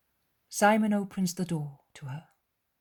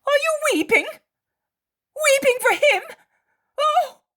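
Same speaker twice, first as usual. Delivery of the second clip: very high, loud startled cry; surprised dismay; 11 dB louder than usual. Acted. Mary of Alphaeus shocked to see Mary weeping. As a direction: gasp at her tears. Friend noticing tears.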